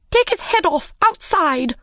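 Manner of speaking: high, shouted royal order; queen shouting her order after the fleeing Hatter; Queen's shout. A narrator's reading. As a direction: yell it after him as he runs. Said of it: "shouted the Queen".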